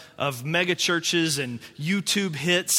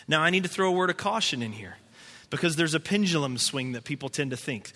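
usual speaking voice

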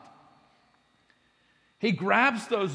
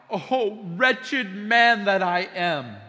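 anguished outcry, slow and louder; crying out; 2 semitones higher, 5 dB louder, much slower than usual. Acted. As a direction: cry it out like a dramatic lament. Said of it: "to cry out".

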